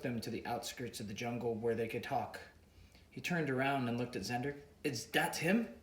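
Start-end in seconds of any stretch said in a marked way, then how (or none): 4.8-5.7 put-on higher questioning in an African accent; Mister Boga, African accent, suspicious; thick African accent. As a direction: African accent, lightly voiced. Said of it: "he asked in a thick African accent".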